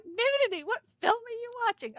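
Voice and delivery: high, incredulous disbelief; surprised. Retold or imitated. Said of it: "I'm like".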